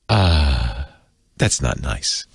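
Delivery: a low, prim little rebuke from a machine; imitating Google. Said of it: "Google goes".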